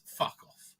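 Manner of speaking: breathy whispered dismissal; whispered fuck off. Real, not acted. Brushing off the producers, a whispered fuck off. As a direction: breathe it out as a scornful aside.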